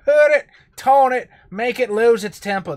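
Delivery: put-on a loud, high Australian-sounding accent; a Cockney voice that turns Aussie; Steve Irwin-like accent. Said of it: "That was Steve Irwin again".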